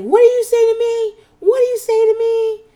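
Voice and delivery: high, loud hammed-up yelling; mock furious yell. Renee throwing the purse down at Ramona, mock shrieking. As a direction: shriek the question at her, hammed up. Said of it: "she's like".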